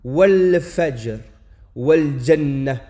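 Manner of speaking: Wal fajr, wal jannah, le lam net, plus fort et lent; lam prononcé. Shown as a demonstration. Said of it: "On prononcera simplement le lem". (prononciation lente et appuyée)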